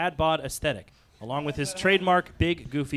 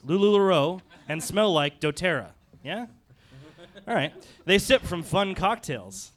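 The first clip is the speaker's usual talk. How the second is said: normal talking voice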